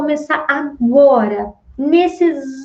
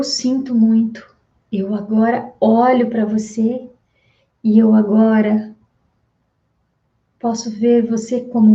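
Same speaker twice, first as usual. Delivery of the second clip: guided meditation phrase; 3 semitones lower than usual. Acted. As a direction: say it calmly to the partner as a release. Guided healing phrase to partner.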